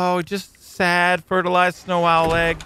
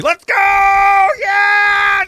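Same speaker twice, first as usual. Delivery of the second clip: high, loud battle cry; whooping as he dives down; excited shout; 14 semitones higher, 7 dB louder, much slower than usual. Real, not acted. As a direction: yell it as you launch off the edge. Launching the dive.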